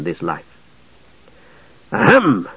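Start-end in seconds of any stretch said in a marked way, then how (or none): 1.9-2.5 a pointed, attention-getting ahem; mock throat-clear to interrupt; loud throat clearing. A narrator's reading. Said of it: "He cleared his throat loudly".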